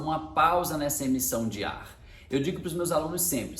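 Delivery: ordinary delivery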